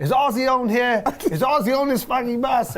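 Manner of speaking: high, hammed-up British roadie voice; British accent. Chaperone barging onto the bus, in a hammed-up British accent. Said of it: "there's a British guy".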